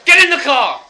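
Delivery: high, loud bellow; officer shouting at a suspect; shouted police order. Retold or imitated. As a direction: yell the command.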